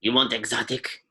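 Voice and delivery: put-on cranky old alien lady in broken Basic; old shopkeeper voice, grumbling; old Rhodian shopkeeper. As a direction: threaten then warm up, in character. Shopkeeper character voice.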